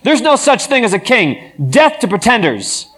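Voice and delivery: high, loud hostile shout; a patron shouting down the king, hostile. A narrator's reading. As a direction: bellow it from the boxes. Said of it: "shouted out".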